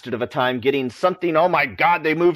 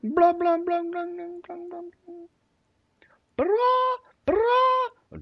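high mock babble; slower than usual. Mocking fast dialogue sound.

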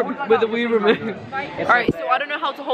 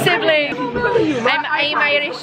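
bad Irish accent; 4 semitones higher, much slower than usual. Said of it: "That's such a bad accent".

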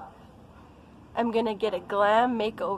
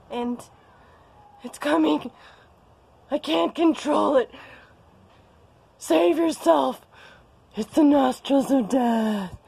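mock horror; 4 semitones higher, 5 dB louder than usual. Mock horror at her own close-up.

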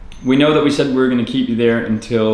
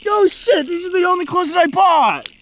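high whiny despair. Acted. Despairs over ruined clothes.

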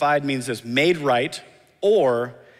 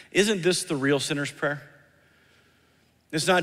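normal talking voice

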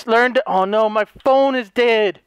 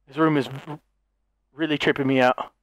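spooked; 10 semitones lower, 5 dB quieter than usual. Real, not acted.

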